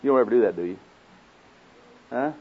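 usual speaking voice

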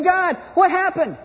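high, drawn-out mock cry to God; sgomento recitato. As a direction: cry out in hammed-up dismay. Acted cry to God after tragedy.

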